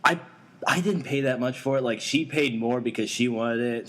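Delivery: normal talking voice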